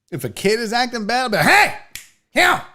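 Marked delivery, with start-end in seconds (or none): 1.5-2.6 higher, louder grumpy grandpa bark; a lightly voiced grandpa telling a kid off; old grandpa scolding. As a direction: snap at the kid like a cranky old man. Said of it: "my old grandpa shit".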